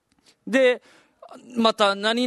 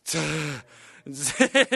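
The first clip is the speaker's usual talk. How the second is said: imitated asthma wheeze; 3 semitones lower than usual. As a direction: mimic an asthma attack's long wheeze. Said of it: "したらもう全速".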